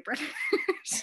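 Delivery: breaking into laughter on the last word; amused, laughter spilling out; laughing. Real, not acted. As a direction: tell it through a laugh. Cracking up at own story.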